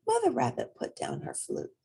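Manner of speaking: plain reading voice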